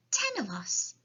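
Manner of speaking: put-on high-pitched little girl voice; small, high child's reply; Sophie. A narrator's reading. Sophie answers the giant.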